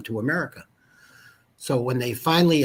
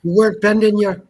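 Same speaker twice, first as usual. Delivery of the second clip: louder, higher Irish brogue; jokey Irish pub accent teasing a friend; 9 semitones higher, 11 dB louder than usual. Pretend. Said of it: "I was surprised by your accents too".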